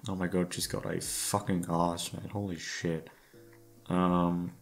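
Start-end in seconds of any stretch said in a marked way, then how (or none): none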